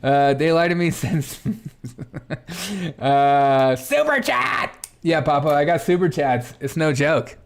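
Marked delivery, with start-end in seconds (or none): 3.8-4.9 high, drawn-out cry of mock excitement; hammed-up announcement. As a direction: stretch it out high like a playful fanfare. Hamming up a donation announcement.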